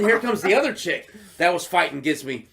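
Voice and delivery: ordinary talk, nothing marked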